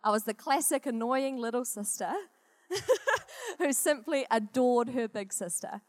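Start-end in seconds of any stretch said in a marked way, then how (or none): 2.7-3.5 light laugh. Real, not acted. Admits being annoying little sister.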